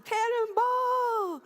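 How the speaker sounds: high, slow, savoring the word Cannonball; delighted drawn-out echo. Real, not acted. Pastor relishing a congregant's idea, high drawn-out delight. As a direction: stretch Cannonball out with glee.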